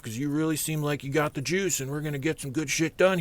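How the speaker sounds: butter him up to join the team; smooth-talking boss. Retold or imitated. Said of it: "He's like".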